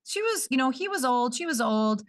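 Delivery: put-on high, offhand childlike voice; child shrugging off death. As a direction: toss it off like a small child. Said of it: "they're just like".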